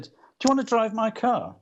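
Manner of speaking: put-on high, lilting Liverpool accent; playful Liverpool impersonation. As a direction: imitate a Liverpudlian, pitched well up. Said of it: "in his Liverpool accent".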